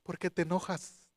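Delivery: acting God's quiet inner voice. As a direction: speak softly as God gently questioning. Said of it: "y entonces la voz de Dios".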